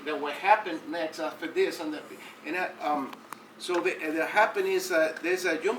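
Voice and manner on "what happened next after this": ordinary delivery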